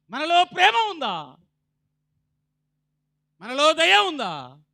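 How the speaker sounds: high, loud fiery sermon voice; preaching at full force. Acted. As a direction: thunder the questions at the congregation.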